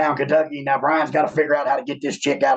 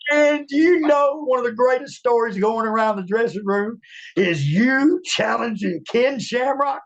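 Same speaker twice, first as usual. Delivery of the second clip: excited retelling; 8 semitones higher than usual. Retold or imitated. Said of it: "He said".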